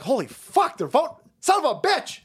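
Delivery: climbing mock dismay; mock panicked insiders. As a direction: elites caught off guard, mock panic rising. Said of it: "And they're like".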